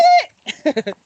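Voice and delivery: breathless laugh on the run. Real, not acted. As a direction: laugh high while dashing. Laughing while running.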